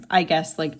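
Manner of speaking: normal talking voice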